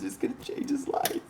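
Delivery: breathy high whisper, almost choked up. Real, not acted. Urging care for soldiers, whispered with feeling. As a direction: whisper it like a heartfelt secret. Preaching love for returning soldiers.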